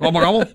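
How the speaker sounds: high turkey gobble; gobbling turkey voice. Pretend. Turkeys answer the pretend police.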